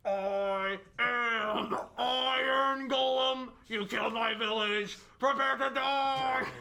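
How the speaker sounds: huge, slow, high booming monster roar. Acted. Iron golem threatens the villagers' killers, booming. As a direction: bellow it slowly as a giant iron golem. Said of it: "It spoke with a booming voice".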